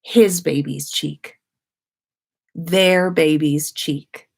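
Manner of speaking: ordinary delivery, nothing marked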